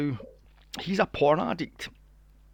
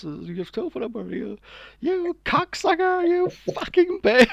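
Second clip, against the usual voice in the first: high, loud, mock-outraged insults, breaking into a laugh; mock shouting prank caller; 6 dB louder than usual. Retelling the prank call, a high loud mock scream. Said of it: "And she goes".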